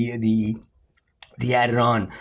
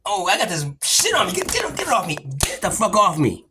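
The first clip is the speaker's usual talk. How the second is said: higher, irritated mock voice; annoyed waking grumble, lightly voiced; Mother Earth shrugging you off; 6 semitones higher than usual. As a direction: brush the pests off like a roused sleeper. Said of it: "and then you go like".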